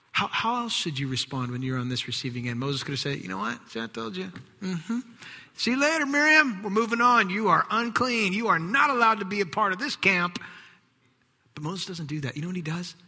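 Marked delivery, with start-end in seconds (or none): 3.2-10.4 high, loud gloating send-off; mock-smug brother banishing his sister; smug dismissal. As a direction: wave her off with a sneer. Imagined Moses dismissing Miriam.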